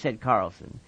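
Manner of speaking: usual speaking voice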